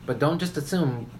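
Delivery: ordinary talk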